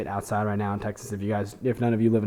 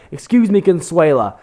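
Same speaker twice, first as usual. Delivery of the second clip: loud, higher sneering caricature of a rude man; 6 semitones higher, 10 dB louder than usual. Retold or imitated. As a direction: ham up an ignorant customer demanding help. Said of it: "the only way he could be more racist by saying something like that would be like".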